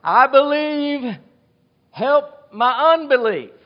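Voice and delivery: high, loud, slow anguished plea; crying out. Acted. Desperate father cries out to Jesus, loud plea. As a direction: cry it out from the gut. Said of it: "the father cries out".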